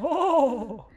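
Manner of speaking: high breathy swooning Oh; high airy oh. Shown as a demonstration. Showing the pretty-boy Thor sound, high swooning oh. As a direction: repeat the dreamy Thor sigh, high. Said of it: "That's just".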